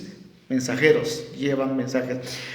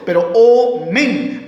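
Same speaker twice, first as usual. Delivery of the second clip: omen said slow and deliberate; slow stressed omen; 4 semitones higher, 4 dB louder, much slower than usual. Shown as a demonstration. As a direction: draw out omen, lean on it. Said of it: "acento en la O". (omen pronunciado lento y marcado)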